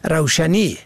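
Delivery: ordinary talk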